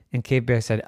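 ordinary delivery, nothing marked

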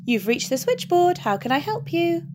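sing-song receptionist greeting; a hint of a cheerful heavenly operator; lilting switchboard voice. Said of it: "a lilting voice filled the room".

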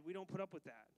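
ordinary delivery

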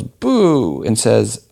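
high-pitched, drawn-out audience boo; mock heckler yell. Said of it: "someone in the audience yells".

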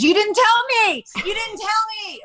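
very high-pitched whiny protest; imitating protesting husband. Wife mimics husband denying he was told, high protest. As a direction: mimic him squealing in indignant denial. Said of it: "And he goes".

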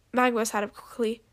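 ordinary delivery

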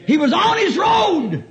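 shouted high preaching. Acted. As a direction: preach it out, loud and slow. Preaching at full force.

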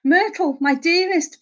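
high, anguished endearment; pleading. A narrator's reading. Husband calls to his suffering wife, pleading. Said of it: "pleaded Horace".

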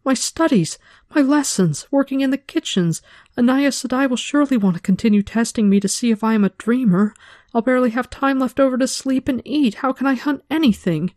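high, panicked fretting; panicky. A narrator's reading. Frightened apprentice fretting to herself, high and panicky. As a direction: rattle off the list in rising panic.

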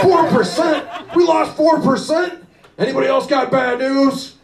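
outraged boss voice. Pretend. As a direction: play the furious boss at the meeting. Boss voice hearing bad news.

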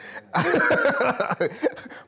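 high burst of laughter; laughing. Real, not acted. Preacher amused by his own accent, laughing. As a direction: laugh at yourself, high and long.

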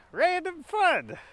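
high, slow, delighted squeal; random fun. Acted. Vlogger cheering herself up, high and slow. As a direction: sing it out like a happy cartoon. Playful exclamation, drawn out.

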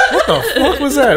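high, laughing mock disbelief; retold bafflement. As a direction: replay a baffled reaction through laughter. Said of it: "And I'm like".

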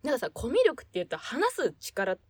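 usual speaking voice

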